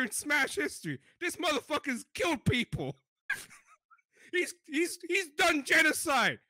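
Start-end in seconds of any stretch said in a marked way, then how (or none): 4.3-6.4 laughing outburst. Real, not acted. Laughing outrage at violent character.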